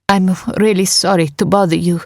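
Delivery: a touch of apologetic helplessness; lightly voiced Nika. A narrator's reading. Nika distracting Phil at the door, apologetic. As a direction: politely plead with a stranger. Nika's voice in narrated story.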